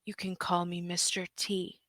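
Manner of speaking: quiet, understated boss introduction; lightly voiced merman, soft and unhurried. Merman introduces himself to visitors, quiet understated boss voice. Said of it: "He just goes".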